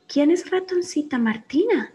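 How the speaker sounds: a small curious cookie asking; little voice. Acted. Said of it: "a little voice said".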